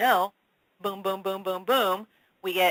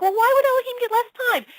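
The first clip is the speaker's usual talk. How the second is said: mock indignant whine; 6 dB louder than usual. Retold indignant question.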